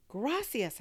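excited shout of thanks in Spanish. Acted. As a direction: call it down the phone, overjoyed. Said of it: "I shouted into the phone".

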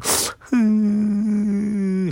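long high soft whimper; thin, drawn-out mock crying whine; imitated whimper. Storyteller imitating the crying behind the door, soft whimper. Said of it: "like, whimpering".

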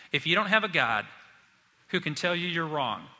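usual speaking voice

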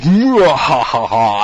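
slow, loud gloating cackle; drawn-out villain laugh; evil overlord laugh. Pretend. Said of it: "some sort of evil overlord".

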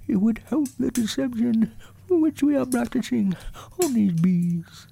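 very high, soft, earnest little bear; thin-voiced toy bear giving careful instructions; Winnie the Pooh. A narrator's reading. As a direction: explain a plan softly in a small, high voice.

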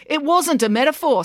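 insist, annoyed and high; indignant. A narrator's reading. April correcting teacher, indignant.

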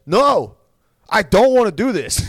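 loud, high petulant refusal; Nick. Retold or imitated. Fighter refusing an interviewer's cheery question, loud and high. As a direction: blurt it out, mock-reluctant fighter. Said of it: "He's like".

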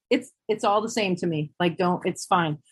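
normal talking voice

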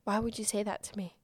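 whispered inner doubt, fading off; doubtful whisper. Retold or imitated. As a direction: hush it like a private worry. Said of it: "a piece of self-doubt that I'm like".

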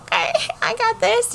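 high, fast, giggly put-on voice; laughing mock confidence. [name] telling Maine she can do it, laughing. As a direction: chirp it through a laugh.

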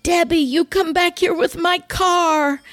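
mock yell of a cross grandmother; imitated grandmother's yell. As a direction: call her back from across the front yard, pitched high. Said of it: "standing in the front yard yelling".

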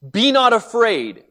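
calm him firmly, slowly; firm reassurance. Retold or imitated. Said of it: "he looks at Jairus ... he says".

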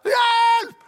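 high, loud cry of help; shout for help. Retold or imitated. Movie hero on a ledge yelling for help. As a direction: yell it like hanging from a cliff. Hanging man cries for help.

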